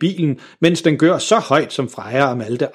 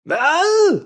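much higher, drawn-out call; shouted what. A narrator's reading. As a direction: yell it over the engine again. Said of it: "råber faren igen". (faren råber igen over motoren)

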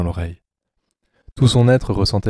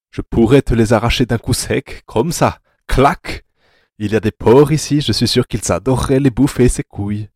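loud, raised, relishing threat; gleefully threatening captor; 4 semitones higher, 5 dB louder than usual. Acted. Anatole threatens paralysed Rainer, gleefully menacing and loud. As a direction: menace him with animated, savage glee.

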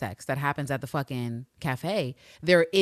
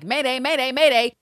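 louder, higher alarm call, half-joking; mock mayday call; 7 semitones higher, 7 dB louder than usual. Host reacting to Eve missing her bug, mock alarmed call.

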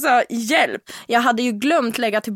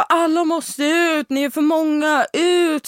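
slow commanding police voice, higher; police order; 4 semitones higher, slower than usual. Retold or imitated. Police clearing out the teen party, ordering slowly. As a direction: order the crowd out like a police officer. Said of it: "de var ju så här bara".

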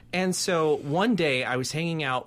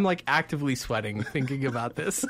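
laughing. Real, not acted. Nervous laughter at the story.

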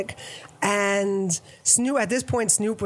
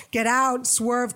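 normal talking voice

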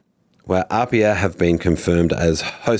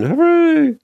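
squeaky, much higher hammed-up hooray; mock cheer; 4 dB louder than usual. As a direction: cheer it in a high squeal as a joke. Mock cheer in news read.

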